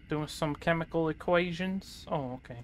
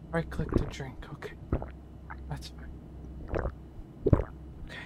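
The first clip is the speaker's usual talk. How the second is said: whisper; 2 semitones lower, 5 dB quieter than usual. Real, not acted. Quietly reading prompts.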